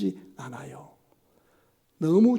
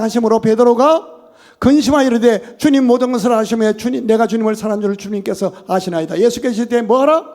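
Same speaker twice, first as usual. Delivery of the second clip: loud, raised proclamation of the text; preached scripture reading; 6 semitones higher, 11 dB louder than usual. Acted. Pastor reads John aloud to the church, loud and fervent. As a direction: proclaim the verses to the hall.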